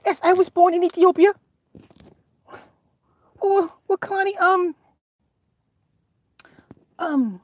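very high, whiny pleading; dad pressing the girl, very high and near tears; strained dad. Retold or imitated. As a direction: beg for an explanation.

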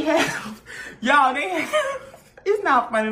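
breaking into laughter mid-sentence; can't get through it for laughing. Real, not acted. Telling viewers about the scandal, cracking up laughing. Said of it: "That's why I'm laughing".

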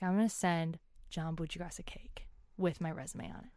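a soft retold whisper of her scheme; whispered plan. A grad whispering her cake plan to herself, mock conspiratorial whisper.

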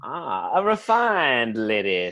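put-on low, slowing southern accent; genteel southern accent. Southerner meeting a cursing lady, genteel southern accent. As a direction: admire her like a courtly southerner. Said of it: "genteel way that you guys talk".

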